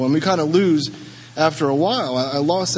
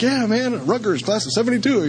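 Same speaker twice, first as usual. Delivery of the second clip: higher, hammed-up frat-guy voice; mock proud alumnus; 7 semitones higher than usual. A decked-out alumnus bragging to the newcomer, hammed-up and higher. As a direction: play the beaming old grad. Mimicking a cheerful Rutgers alumnus.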